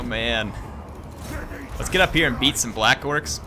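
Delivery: usual speaking voice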